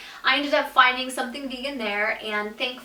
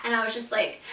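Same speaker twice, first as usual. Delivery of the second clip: ordinary delivery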